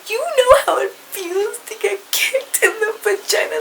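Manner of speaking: high, whiny mock sob voice; mocking crying girl. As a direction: whine it like a sobbing teen being mocked. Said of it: "this bitch is crying to me".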